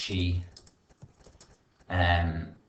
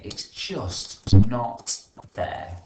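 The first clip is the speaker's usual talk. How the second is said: much louder, slow sulky whine; spoiled whine; 10 dB louder, much slower than usual. Acted. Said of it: "what would a spoiled person say".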